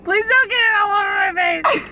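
laughing plea. Real, not acted. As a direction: beg her while cracking up. Mom pleading through laughter.

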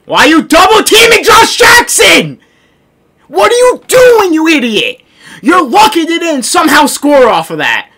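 high, loud outraged shouting; furious scolding. Real, not acted. Gamer berates teammate for double teaming, furious shouting. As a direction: yell at an idiot teammate.

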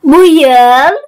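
slow, word by word with long pauses; dictating. Shown as a demonstration. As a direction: read it out slowly for pupils to write down. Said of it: "Ben metni yavaş yavaş okuyacağım".

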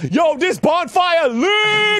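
high slow drawn-out yell; hyped shout. Real, not acted. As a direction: stretch it out, loud and high. Hyped about bonfire.

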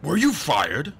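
loud stern question. A narrator's reading. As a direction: press him sternly. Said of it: "asked sternly".

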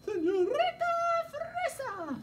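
very high cartoon character; squeaky puppet voice. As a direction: squeak out the name.

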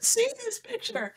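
giddy, beaming at mom; excited. Retold or imitated. Scientist showing her mother the image, giddy. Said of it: "I'm like".

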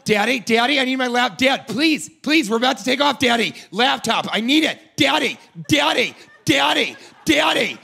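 high, insistent daughter whine building to shouts; whiny daughter. Acted. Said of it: "the daughter sounded like".

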